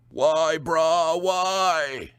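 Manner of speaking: high, pained groan. Acted. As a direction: groan it out in pain. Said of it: "the man groaned".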